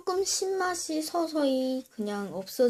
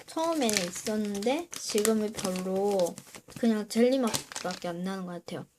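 usual speaking voice

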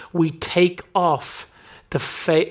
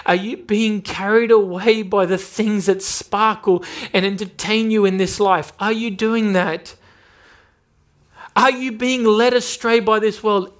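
rising, urgent preacher's challenge; preaching at full force; 5 semitones higher than usual. Acted. Preacher confronting believers about worldly pleasures, urgent and climbing. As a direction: press the church with each question, climbing higher.

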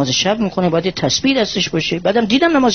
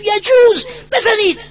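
very high, loud cry of permission; tearful shout; 13 semitones higher, 3 dB louder, slower than usual. Retold or imitated. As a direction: cry it out through tears. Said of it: "داد زد با گریه".

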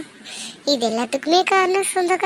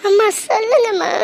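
tearful plea; 4 semitones higher than usual. Acted. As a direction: beg her mother through tears. Daughter pleads, crying.

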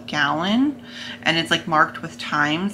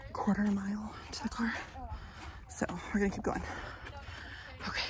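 whisper; 4 semitones higher, 14 dB quieter, faster than usual. Real, not acted. Whispering near the church.

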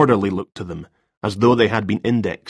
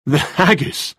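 amused, higher disbelief; smile in his voice; 6 semitones higher, slower than usual. Acted. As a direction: hold back a grin. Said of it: "couldn't disguise a smile in his voice".

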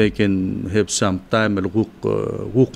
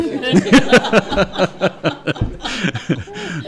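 a burst of real high laughter; laughing; 9 semitones higher than usual. Real, not acted. Laughs at own family anecdote.

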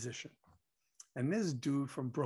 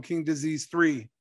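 ordinary talk

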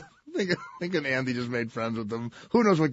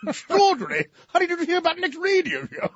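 posh English tourist. Acted. English couple greeting the radio host, mock English accent. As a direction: put on a hammed-up English toff, pitched up. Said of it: "horsey face English people".

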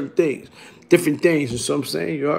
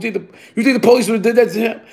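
quoted bystander; 4 semitones higher, faster than usual. Retold or imitated. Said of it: "he say the police".

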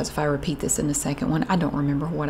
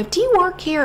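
much higher, loud dopey shopper; mock customer voice; 11 semitones higher, 5 dB louder than usual. Oblivious shopper pestering the clerk, high and loud.

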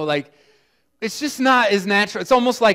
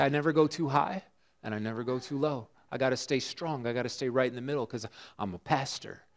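quiet reflective preaching; 6 semitones lower, 9 dB quieter than usual. Acted. Soft reflective sermon close.

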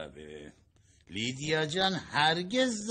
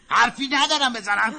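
higher, louder, spelled-out repeat; 8 semitones higher, 8 dB louder than usual. Acted. Husband repeating his answer louder to his wife. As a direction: push it at her so she hears. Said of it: "چی؟".

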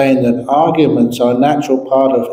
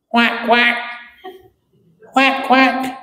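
high duck quacking; 9 semitones higher, slower than usual. Acted. Duck sound in the story.

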